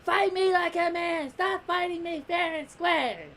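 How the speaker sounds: high-pitched paranoid outburst; put-on high, loud voice, accusing her opponent; Ribrianne. Paranoid fighter challenging eighteen mid-fight, mock high-pitched cry. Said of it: "Ribrianne just starts getting paranoid with eighteen".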